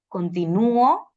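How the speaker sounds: "continuo" said slowly as con-ti-NÚ-o; stress on penultimate syllable. Shown as a demonstration. Teacher contrasting meanings by accent, "continuo" stressed on NÚ. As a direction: hit the NÚ in "continuo". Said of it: "otro ejemplo de esto".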